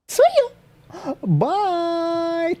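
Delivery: squeaky high-pitched farewell; cute high goodbye. Acted. Cute farewell to stream viewers.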